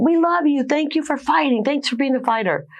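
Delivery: high-pitched, gushing fan voice; imitated gushing fans. New York fans gushing, retold.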